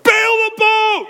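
very high, drawn-out panicked shout; yelling. Retold or imitated. Boat driver to the kids as water rises, frantic yelling. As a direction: yell it at the top of your voice. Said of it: "I'm just yelling at them".

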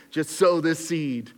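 a light, slow send-up of a TV preacher; lightly mock the televangelist pitch. Pastor mocking preachers on TV, lightly hammed-up televangelist. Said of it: "I shouldn't do an accent right now".

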